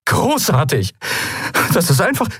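high, exasperated outburst; angry sarcasm. Acted. Kai answers the admiral's calm admission, exasperated.

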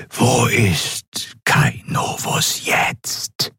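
slow, whispered voice of command; menacing Excellency. A narrator's reading. Ruler demanding the admiral's report, slow menacing whisper. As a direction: whisper each word with drawn-out authority.

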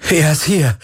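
high, joyful cry; jubilant. A narrator's reading. Galen tells Ki his friend is found, jubilant cry. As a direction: exclaim it in triumph. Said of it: "jubelte Galen".

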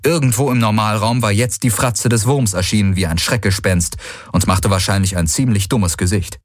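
usual speaking voice

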